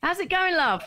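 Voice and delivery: high, sing-song greeting; chirpy greeting. Retold or imitated. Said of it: "mock greeting".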